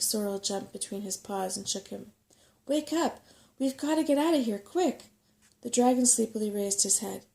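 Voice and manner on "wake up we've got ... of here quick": higher and fast, urgent. A narrator's reading. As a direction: hurry him up, almost pleading. Shaking the dragon awake, urgent.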